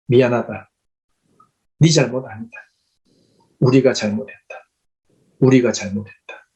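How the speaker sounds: low, sincere apology with long pauses. Retold or imitated. Pastor holding the student's hand to apologise, low and sincere.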